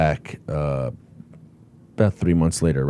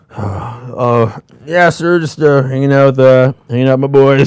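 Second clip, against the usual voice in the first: high, loud sheepish answer; sheepish loud reply; 5 semitones higher, 8 dB louder than usual. Retold or imitated. Loya answering the officer's taunt, high loud sheepish reply. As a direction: call back high and loud, caught out. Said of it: "And he'd be like".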